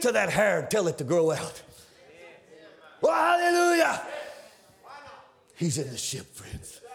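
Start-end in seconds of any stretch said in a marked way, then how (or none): 3.0-4.0 high, loud joyful cry; shouted hallelujah. Acted. Preacher praising in the middle of the sermon, shouting.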